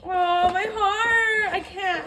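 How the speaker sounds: high, slow, melting cry; overwhelmed. Real, not acted. Overcome by a baby gift, melting and tearful. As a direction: coo it as if your heart melts.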